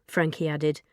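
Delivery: ordinary delivery, nothing marked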